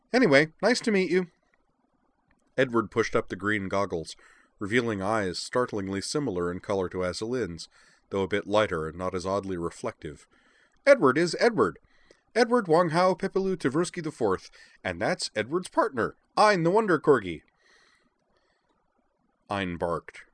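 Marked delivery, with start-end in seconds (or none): 0.1-1.3 high, loud, chirpy greeting; Edward. A narrator's reading. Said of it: "declared in a high voice". 10.8-17.4 high, loud, proud announcement; Edward. A narrator's reading. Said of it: "declared in a high voice".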